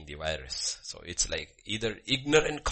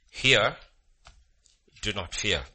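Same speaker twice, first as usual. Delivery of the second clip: ordinary delivery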